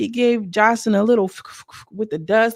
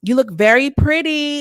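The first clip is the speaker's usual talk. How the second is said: fake sweet compliment; 5 semitones higher, 5 dB louder than usual. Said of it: "Isis was like".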